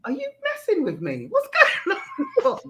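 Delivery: half-laughing, incredulous; laughing disbelief. Retold or imitated. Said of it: "I was like".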